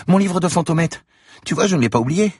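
put-on high bright child voice, proud; a child showing off her book; happy little girl. A narrator's reading. Girl shows Franck her book, high proud child voice.

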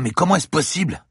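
high, panicky outburst; panicked. Acted. Del Piero panicking at the news of the insects. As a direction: blurt it out in sudden panic. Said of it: "Paniqua Del Piero".

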